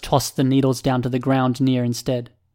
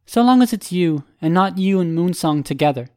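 higher, raised voice for Furcate; Furcate's character voice; 5 semitones higher, 3 dB louder than usual. Acted. Furcate setting terms to Byron, high character voice. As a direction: pitch it up as a distinct character.

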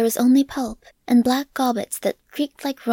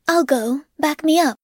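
woman soldier; 4 semitones higher, 4 dB louder than usual. A narrator's reading. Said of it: "was a woman's voice".

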